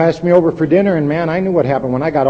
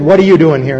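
louder, hostile challenge; rude officer; 3 semitones higher, 8 dB louder than usual. Retold or imitated. Hostile officer confronting Ed at the hospital, louder rude challenge. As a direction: snap it at him like a resentful cop. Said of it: "very vulgar and very kind of foul and rude".

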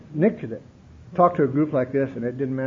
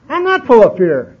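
pushed up high and louder on the repeat; louder insistence; 8 semitones higher, 10 dB louder than usual. Retold or imitated. Protesting to the old timers, louder and higher.

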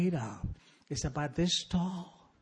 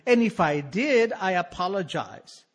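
higher, louder start on oh, then lighter father; a lightly voiced father, fake-sincere; hint of a wheedling father apologizing; father's letter voice; 4 semitones higher, 6 dB louder than usual. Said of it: "he continues".

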